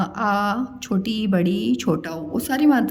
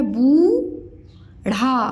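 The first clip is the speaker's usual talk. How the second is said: बूढ़ा drawn out slowly as two separate parts; syllable by syllable; much slower than usual. Shown as a demonstration. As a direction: sound out बूढ़ा with a long बू, then ढ़ा. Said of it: "मैं अलग-अलग बताया करूँ". (बूढ़ा को अलग-अलग तोड़कर, धीरे)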